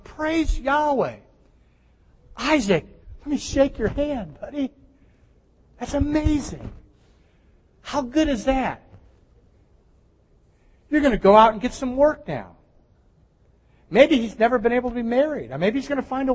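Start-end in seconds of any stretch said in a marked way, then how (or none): 0.0-1.2 slow, higher joyful cry; joyful praise. Pretend. Pharisees who should praise God, joyful and slow. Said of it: "Why did the Pharisees go". 2.4-12.6 higher, beaming congratulations; delighted greeting. Pretend. Pharisees congratulating healed Isaac, beaming and higher. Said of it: "Why did the Pharisees go".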